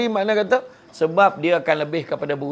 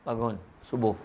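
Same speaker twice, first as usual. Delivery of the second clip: quietly coaxing, much softer; soft and slow; 5 semitones lower, 9 dB quieter than usual. Retold or imitated. Father softly waking the children for dawn prayer, hushed. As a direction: wake them gently, barely above a murmur. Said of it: "cakap slow-slow".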